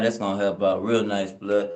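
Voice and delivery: ordinary talk, nothing marked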